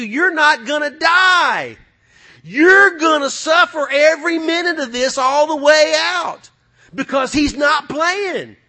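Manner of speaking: high, loud preacher's warning, slowing to a shout; slow, pounding hellfire shout; fiery preaching. Acted. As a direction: hammer the doom home like a revival preacher. Preaching the torment at full force.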